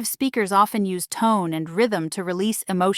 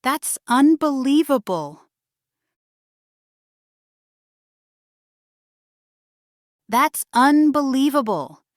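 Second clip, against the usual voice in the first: raised pitch, astonished emphasis; surprised; 4 semitones higher than usual. Shown as a demonstration. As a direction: exclaim it in disbelief. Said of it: "let's explore surprise".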